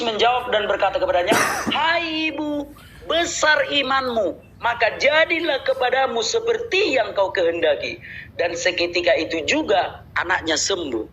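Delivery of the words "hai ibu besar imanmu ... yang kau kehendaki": exalted Jesus. Acted. Voicing Jesus' answer.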